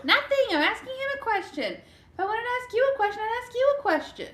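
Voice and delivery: high, huffy put-on voice; sassy. Sassy retort to being questioned.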